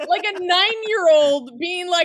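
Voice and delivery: laughing. Real, not acted. Laughing retelling.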